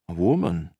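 a touch of wonder; mild wonder. A narrator's reading. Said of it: "one of the cops said wonderingly".

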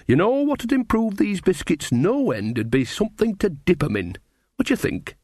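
folksy, lilting and suggestive; chatty Nanny figure. Acted. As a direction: angle for a treat in a rustic character voice. Nanny's dialogue in story.

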